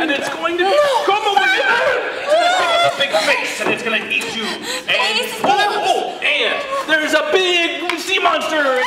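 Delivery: excited shouting. Real, not acted. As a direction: whip up the scene wildly. Directing the actors excitedly.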